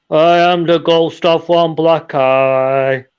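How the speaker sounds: slow, drawn-out, eerie ghost moan. Acted. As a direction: stretch every word like a spooky ghost. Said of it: "it's same voice".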